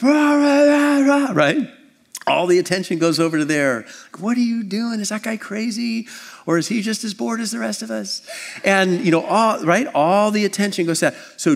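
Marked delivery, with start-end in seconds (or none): 0.0-1.3 loud, high-pitched wordless yell; mock yell. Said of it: "and just yells". 4.2-8.2 much higher-pitched imitation of a bewildered crowd; puzzled onlookers. Voices startled crowd reacting to yeller.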